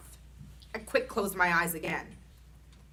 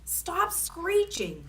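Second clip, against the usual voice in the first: pleading slowly; 10 semitones higher, much slower than usual. A narrator's reading. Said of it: "he said".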